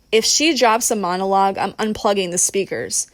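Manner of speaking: plain reading voice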